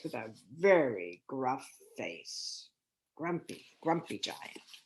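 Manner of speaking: soft whispered teasing; hushed whisper. A narrator's reading. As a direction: whisper it to the children like a secret. Reader showing the giant's picture.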